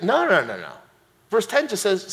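ordinary talk

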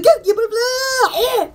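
ordinary talk

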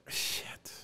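whispered retold curse; dismayed whisper. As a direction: let the curse out under your breath. Retold dismay at lost buyers.

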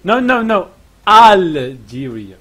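normal talking voice

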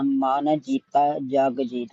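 ਜੀਤਾ said firmly and slowly with a clear ending; tight ending on jeeta. Shown as a demonstration. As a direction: stretch out ਮਨ ਜੀਤਾ, firm on ਜੀਤਾ. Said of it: "ਜੀਤਾ ਕੱਸ ਕੇ ਪੜਨਾ". (ਜੀਤਾ ਕੱਸ ਕੇ, ਹੌਲੀ ਪੜ੍ਹੋ)

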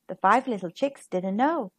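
ordinary delivery, nothing marked

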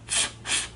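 "f, f" as pure voiceless hissed f with no vowel; soft isolated f sounds. Shown as a demonstration. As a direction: push air through the teeth for "f, f". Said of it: "what sound does F make?".

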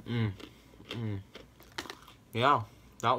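talking while chewing food; muffled words around food; mouth full, chewing. Real, not acted. Seen 3: bites bagel at -1.0, cheeks full and chewing at start.

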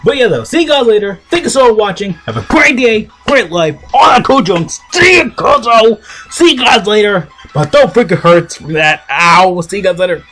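high, loud pushed outro; strained voice. Real, not acted. Energetic outro sign-off.

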